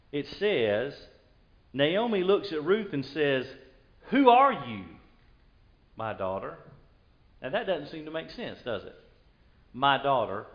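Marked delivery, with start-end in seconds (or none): 4.1-6.6 startled question. Acted. Naomi questions Ruth on returning.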